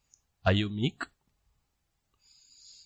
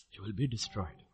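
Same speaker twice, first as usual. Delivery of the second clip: much softer, low solemn echo; hushed repeat; 4 semitones lower, 14 dB quieter than usual. Real, not acted. Preacher repeating God's warning of destruction, hushed. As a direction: let the warning sink in quietly. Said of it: "repeated warning, dropped".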